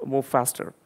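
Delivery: usual speaking voice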